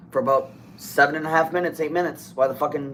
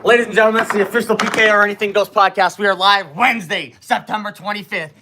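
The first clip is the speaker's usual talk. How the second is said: high, loud show announcer voice; 7 semitones higher, 6 dB louder than usual. Acted. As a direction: proclaim it like a ring announcer. Announces podcast in sign-off.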